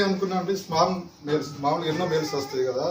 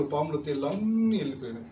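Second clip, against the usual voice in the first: ordinary talk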